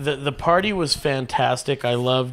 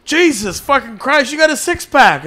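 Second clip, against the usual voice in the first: loud, high, hammed-up amazement; astonished outburst; 10 semitones higher, 7 dB louder than usual. Onlooker to shirtless farm kid, loudly astonished. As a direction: exclaim as if you can't believe it.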